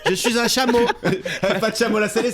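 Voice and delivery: teasing mock role-play. Friend mimicking her at the club, laughing and teasing. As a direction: poke fun at the botched Italian, cracking up. Se moque de son faux italien.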